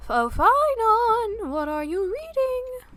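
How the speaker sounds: high, slow sing-song call. Pretend. Player calls to character.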